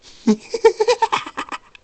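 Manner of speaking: high, loud cackle; maniacal laugh. Acted. Insane Jacob gloating over his control, maniacal cackle. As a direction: cackle wildly, far above your voice. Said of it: "The insane Jiggit laughs".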